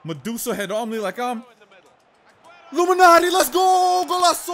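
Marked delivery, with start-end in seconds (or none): 2.7-4.5 shouting high and loud in triumph; goal celebration. Real, not acted. Gamer celebrating his goal, roaring with joy. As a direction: yell it out like you just scored.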